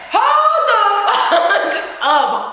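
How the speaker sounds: laughing in disbelief. Real, not acted. Shocked by a character's death.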